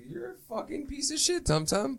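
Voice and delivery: put-on high squeaky kid taunt; high-pitched bratty kid jeering; mock kid voice. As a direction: play the little kid, high and cocky. Kid insults beaten ninja.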